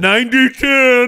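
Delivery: loud, high, drawn-out victory cry; triumphant shout. Real, not acted. Cohost crowing over the poll prediction, triumphant shout. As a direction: crow it loudly, stretching the words. Celebrates winning the predicted vote.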